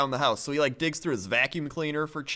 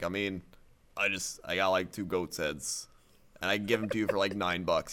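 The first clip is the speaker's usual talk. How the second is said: butcher voice; 8 semitones lower, 6 dB quieter than usual. Retold or imitated. Butcher's offer in retold haggling.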